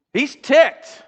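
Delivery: high, loud punchy delivery; emphatic. Real, not acted. Preacher describing the angry king, punchy emphasis. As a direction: drive the point home with a jolt.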